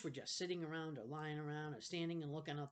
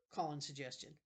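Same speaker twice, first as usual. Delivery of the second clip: ordinary delivery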